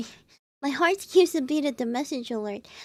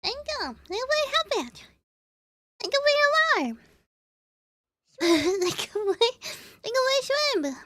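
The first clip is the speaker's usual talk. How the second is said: cutesy thanks; 9 semitones higher than usual. Real, not acted. Streamer thanking chat in a cutesy high voice. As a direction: lisp the thanks cutely up high.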